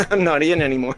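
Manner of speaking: laughing through the words. Retold or imitated. Host joking about giving up on a band, laughing. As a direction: break into a chuckle as you say it.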